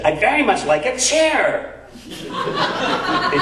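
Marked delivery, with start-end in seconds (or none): 0.1-1.8 Dave's voice. Retold or imitated. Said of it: "He says".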